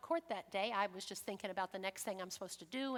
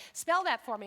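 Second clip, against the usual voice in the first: loud, a bit higher, imitating the reporter; a hint of a brusque clerk, louder; mock loud demand; 3 semitones higher, 9 dB louder than usual. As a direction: bark it out like a court official. Court reporter asks her to spell.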